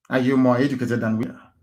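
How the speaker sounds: ordinary talk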